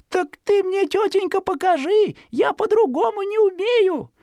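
put-on very high, naive child voice; innocent little boy. A narrator's reading. Zhikharka tricking the fox, very high innocent voice. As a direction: play dumb like a little boy.

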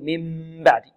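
tight squeezed م before the ب in من بعد; lips pressed too hard. Shown as a demonstration. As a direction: clamp the lips on the hum of من بعد. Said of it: "أو يكز يقول".

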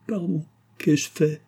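high-pitched, remorseful plea; suppliante. A narrator's reading. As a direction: implore them, overcome with remorse. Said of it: "avec des yeux suppliants". (supplication aiguë, pleine de remords)

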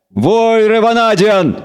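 a newcomer commanding the elves to stop; resonant call. A narrator's reading. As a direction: call out from afar to halt them. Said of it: "раздался вдруг звучный голос".

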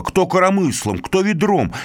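ordinary delivery, nothing marked

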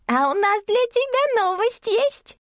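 very high-pitched little squeak; squeaky creature voice. A narrator's reading. Tiny creature underfoot recognises heroine, squeaky. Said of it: "раздалось писклявое".

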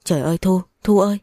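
understated narrated cry of alarm; frightened cry. A narrator's reading. Woman finding Thu hurt on the floor, mildly frightened cry. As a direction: cry out in fright, rising mildly on the second call. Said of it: "Tôi sợ hãi hét toáng lên".

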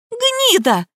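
very high-pitched insult; furious shriek. A narrator's reading. Liza abuses Nika mid-fight, shrieking. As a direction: squeal the insult in rage.

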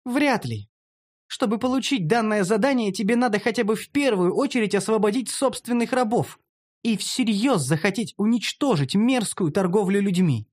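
high, pointed female character voice; lecturing elf. Acted. Narrator voices elf lecturing him.

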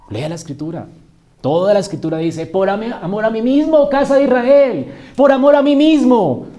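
proclamación fuerte y aguda, subiendo hasta el grito; prédica a plena voz. Acted. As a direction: truena la Escritura ante la congregación. (cita bíblica clamada con fuerza creciente)

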